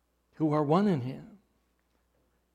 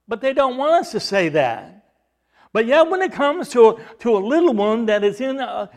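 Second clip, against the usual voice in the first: high, loud pulpit cry; impassioned preacher's challenge; full-force preaching; 5 semitones higher, 10 dB louder than usual. Acted.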